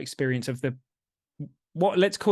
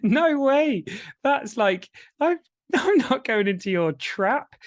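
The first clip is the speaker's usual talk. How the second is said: laughing refusal; 10 semitones higher, slower than usual. Real, not acted.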